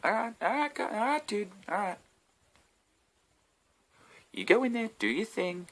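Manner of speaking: high, soft, dismissive go-ahead; mock placating crew. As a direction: humour him like an eager kid. Soldiers sending Brad Pitt in.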